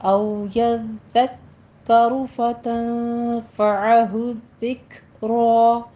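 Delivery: slow measured Quranic chant; tajweed recitation. Shown as a demonstration. Teacher models the verse for students, slow recitation. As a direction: draw each vowel out like a reciter.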